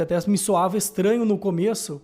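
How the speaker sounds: ordinary delivery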